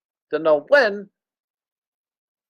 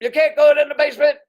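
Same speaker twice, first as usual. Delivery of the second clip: flustered dad; 8 semitones higher, 3 dB louder than usual. Retold or imitated. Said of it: "Pop was like".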